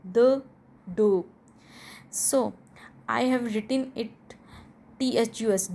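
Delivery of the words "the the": "The" repeated loudly as a short "duh"; loud, separate short "thuh". Shown as a demonstration. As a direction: punch each "The" with a short schwa. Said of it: "its sound will be short".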